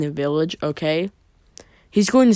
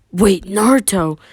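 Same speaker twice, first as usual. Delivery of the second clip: loud, higher call; raised urgent call; 4 semitones higher, 6 dB louder than usual. A narrator's reading. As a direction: call after him as he leaves. Calling after departing Naruto.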